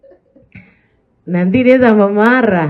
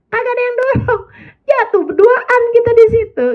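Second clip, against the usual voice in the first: mock whiny wail; 13 semitones higher than usual. Comic picture of both falling.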